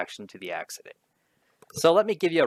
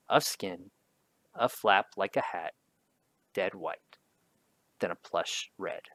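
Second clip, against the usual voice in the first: measured poetry recitation; poem reading; 3 semitones lower than usual. Acted. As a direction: read the lines out, letting each image land. Reads the poem aloud.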